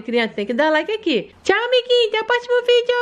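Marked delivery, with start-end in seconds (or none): 1.4-2.9 put-on high childlike voice of a small daughter; sweet little daughter asking mommy for more; little girl voice. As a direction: play the sick little girl talking to mom. Doll says goodbye.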